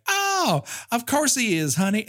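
high syrupy nurse voice; sugary nurse. Retold or imitated. Nurse answering Barbara, high sweet coo.

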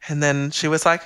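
ordinary talk, nothing marked

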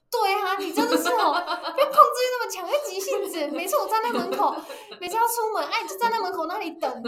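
a laughing send-up of his complaint; mocking husband. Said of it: "然后他说：".

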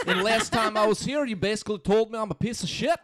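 loud, high Scottish rant; Scottish accent, worsening. Acted. Mock-scolding a friend, slipping Scottish accent. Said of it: "it got worse as that sentence went on".

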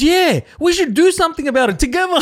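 high, loud mock rally cry; mock fired-up agreement. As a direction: egg the angry man on, overdoing it. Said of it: "He goes".

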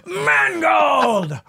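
sudden high shout of the name. Pretend. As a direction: yell it out at the climax. Imagined shout during sex.

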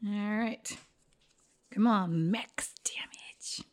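hushed whisper to the dice. Real, not acted. Pleading with dice roll.

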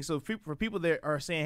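ordinary talk

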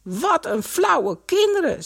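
high, slow indignant exclamation; affronted, pitched well up. A narrator's reading. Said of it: "zei Greet verontwaardigd".

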